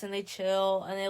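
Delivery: normal talking voice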